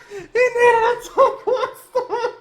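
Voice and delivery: very high, slow, dragged out through laughing tears; despairing slow laugh-cry; laugh-sobbing. Real, not acted. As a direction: wail it, half laughing half crying.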